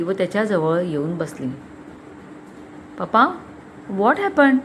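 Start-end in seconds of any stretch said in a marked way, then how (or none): 3.0-4.6 higher, concerned question; anxious daughter. A narrator's reading. Daughter asks her grave father what happened, anxious. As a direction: ask with mild worry.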